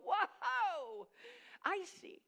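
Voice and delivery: mock amazement; amazed whoa. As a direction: act stunned and impressed. Everyone reacts in amazement.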